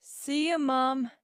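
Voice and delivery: high parting call; calling back goodbye. A narrator's reading. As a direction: call a farewell back on your way out. Son calls goodbye back to mother.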